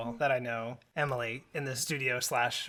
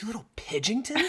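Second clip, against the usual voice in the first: put-on mildly frustrated child, higher pitch; mock childish name-calling, pitched up; imitating frustrated child; 7 semitones higher than usual. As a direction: spit it like a small child's cuss word. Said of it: "she got really frustrated with me".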